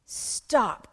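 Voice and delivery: loud, abrupt cry to halt. Acted. Pablo steps in between squabbling friends, crying out. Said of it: "cried Pablo".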